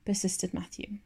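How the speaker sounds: ordinary delivery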